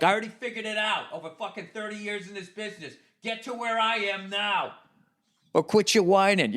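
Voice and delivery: scornful veteran. Retold or imitated. A jaded veteran telling newcomers to quit whining. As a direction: talk down to the newcomer. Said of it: "It's just like".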